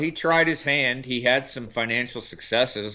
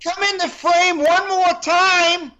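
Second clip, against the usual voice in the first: furious high yell, hammed up; loud, high-pitched mock tirade; Bale yelling; 6 dB louder than usual. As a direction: threaten at the top of your voice. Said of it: "do the impression of Bale yelling at him".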